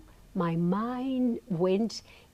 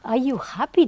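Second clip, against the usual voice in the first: louder, scornful repeat of the question; mock disbelief, louder; incredulous echo; 5 semitones higher, 5 dB louder than usual. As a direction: throw it back as if it's absurd. She echoes it in disbelief.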